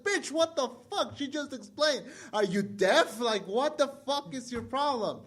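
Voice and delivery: high, drawn-out disbelief at someone not listening; exasperated outburst. Retold or imitated. Fan berating an interviewer who repeated her question, exasperated.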